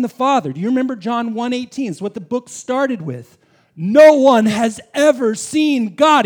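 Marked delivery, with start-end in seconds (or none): 3.8-6.2 loud, slow, weighty proclamation; slow preacher's boom; emphatic slow declaration. Acted. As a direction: land each word like a verdict. Preacher quoting verse with force.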